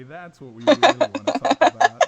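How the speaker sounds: loud high excited agreement. Real, not acted. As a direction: jump in with enthusiasm.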